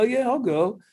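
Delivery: high, eager mock reply; imitating a teammate's high eager answer. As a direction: toss it off in a high teasing voice. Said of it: "And he's like".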